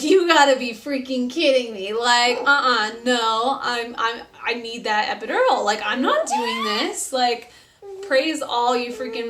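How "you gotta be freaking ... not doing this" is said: hammed-up disbelief; indignant retold refusal. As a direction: dig in and refuse, acting out outrage. Refusing after epidural is denied.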